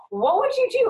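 incredulous question. Retold or imitated. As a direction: ask in disbelief, voice jumping up. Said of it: "'cause I'm like".

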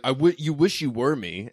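high, loud sneering mimicry; mocking impression. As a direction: parrot him like a smug brat. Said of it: "how he's like".

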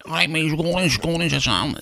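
high, slurred caricature Scouse; put-on Scouse accent. Scruffy passenger tells cab driver the destination, mock Scouse accent. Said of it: "stop doing that Scouse accent".

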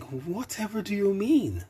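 high coy mock innocence; coy and wide-eyed; classic innocent look. A narrator's reading. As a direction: bat your eyes with your voice. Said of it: "that classic look".

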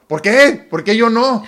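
high, loud, put-out questioning; mock indignation. Acting the husband questioning a happy wife, mock indignant. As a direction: play up the offended disbelief.

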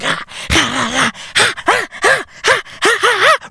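rising, high little ah ah grunts; baby fighting sleep noises. Retold or imitated. Father imitating son's sleepy fighting noises, high-pitched ah's. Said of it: "making those fucking sounds like".

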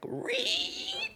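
long high thin squeal; imitated meme squeal; mimicked kid's screech.

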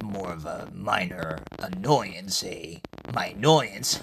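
low, soft, awkward voice; Iridis. Acted. Iridis introducing the newcomer to Francesca, low and awkward.

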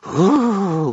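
long, high, loud ooh; awed high-pitched coo; collective fairy ooh. A narrator's reading. Fairies see the pizza, long high ooh. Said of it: "There was a collective".

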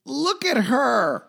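high squeal. Acted. Host introducing guest, playful.

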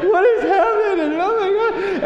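high, panicked wail; scared boys crying in the bathroom, panicked and wailing; crying kids. Retold or imitated. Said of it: "started crying".

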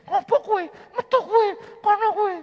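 sick man imagined shouting get out at the pastor; imagined angry yelling. Retold or imitated. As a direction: bark him out of the room in mock rage. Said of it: "Saya tahu mungkin ngomongin".

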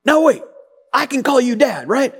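put-on high-pitched little boy reasoning aloud. Foster boy negotiating with his new dad, high child's voice. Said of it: "He goes".

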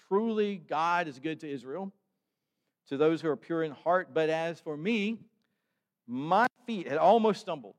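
measured, earnest reading of the psalm; scripture reading. Acted. Pastor reading the Psalm to his congregation, earnest scripture reading.

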